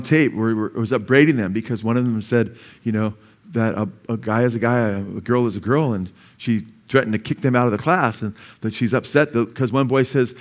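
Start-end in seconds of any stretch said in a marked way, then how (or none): none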